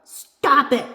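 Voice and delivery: loud, high, sharp burst; cross protest. A narrator's reading. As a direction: shout it crossly while being tickled. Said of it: "she repeats".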